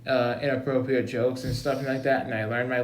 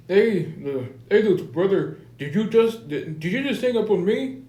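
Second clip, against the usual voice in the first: higher-pitched mock Indian accent; 6 semitones higher than usual. Principal scolds little brother for hanging up, Indian accent. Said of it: "how you say this in an Indian accent".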